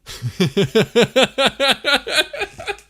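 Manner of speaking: loud, high-pitched laughing fit. Real, not acted. Streamer cracking up at their own accent attempt, high helpless laughter. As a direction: crack up loudly in a long high run.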